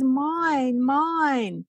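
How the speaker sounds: put-on high pouting toddler whine. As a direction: imitate a little girl whining mine. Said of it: "her little mouth is downturned and she says".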